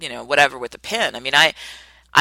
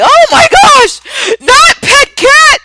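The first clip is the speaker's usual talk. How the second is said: shrieking very high and loud, slow sobbing wail; hammed-up howling over the pet; mock hysterical wail; 15 dB louder, much slower than usual. Friend hears vet news and wails, screaming mock hysterics. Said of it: "they start crying and screaming".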